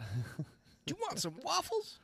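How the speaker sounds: high, slow put-on Eddie Murphy voice; hammed-up celebrity voice; bad Eddie Murphy. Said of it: "That was a very bad Eddie Murphy".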